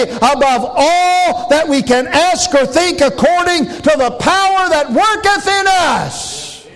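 high-pitched, driving revival preacher; full-force preaching. Real, not acted. Preacher testifying of heaven to the congregation, full-force preaching. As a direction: preach it out high, pushing every phrase.

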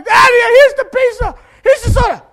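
high, loud shout near crying; tearful joy. Retold or imitated. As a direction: hand it over crying with relief. Boy returns home overjoyed.

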